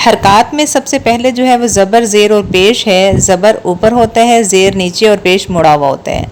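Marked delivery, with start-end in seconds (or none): none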